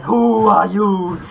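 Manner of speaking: slow, loud, hollow wraith moan; horror wraith voice. Acted. The ghost startles the visitor, slow booming wraith moan. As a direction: drag it out like a spooky wraith. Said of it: "sounding like the whole horror thing".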